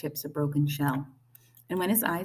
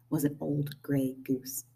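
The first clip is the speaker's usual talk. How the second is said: plain reading voice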